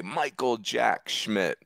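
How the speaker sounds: drawn-out sportscaster's home run call; Harry Callis impression. Recalling a broadcaster's big home run call, slow announcer impression. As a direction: stretch the name out slowly, like an announcer. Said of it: "Harry Callis would say".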